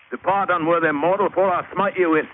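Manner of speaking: high, loud booming god pronouncement; mock god voice. Diver posing as a god to the natives, loud mock divine voice. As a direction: boom it out like an angry idol.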